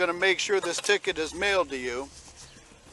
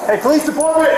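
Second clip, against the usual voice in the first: shouted surrender demands; 8 semitones higher, 10 dB louder than usual. Real, not acted. Police raid calling out.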